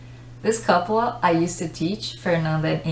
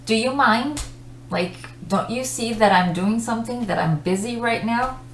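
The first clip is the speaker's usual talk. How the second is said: annoyed; 4 semitones higher than usual. Shown as a demonstration. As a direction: object to being disturbed while busy. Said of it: "but with different intonations".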